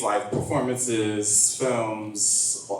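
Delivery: normal talking voice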